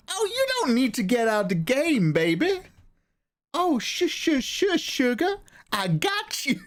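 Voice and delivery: very high, sugary hushing voice; Nathan. Acted. Nathan hushes Mikey out of quitting the game, high coaxing voice. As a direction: coo and shush in a high impression. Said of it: "that's what Nathan sounds like".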